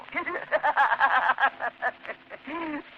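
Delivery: gleeful maniac cackle; mad laugh. Acted. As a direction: laugh like a man losing his mind. Madman laughs at his shadow.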